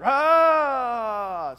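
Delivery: full loud high war shout; battle shout. Retold or imitated. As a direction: roar it across the valley. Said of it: "They would shout".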